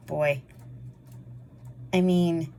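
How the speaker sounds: usual speaking voice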